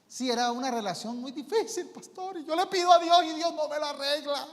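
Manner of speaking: high, loud whining lament; mock distraught churchgoer wailing to her pastor; wailing caller. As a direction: wail like nobody listens to you. Caller complaining about relationship.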